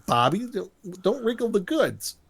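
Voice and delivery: high-pitched imitated objection; hammed-up high protest; mock protest. Acts out Eric reacting to friend.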